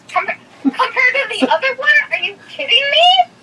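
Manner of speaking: high, wailing outrage; indignant disbelief. Real, not acted. Daughter compared to the other one, indignant disbelief. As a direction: voice shoots up in protest.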